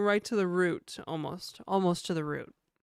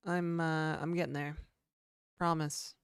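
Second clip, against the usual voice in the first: ordinary talk, nothing marked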